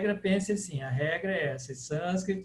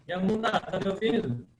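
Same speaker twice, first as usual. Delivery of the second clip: usual speaking voice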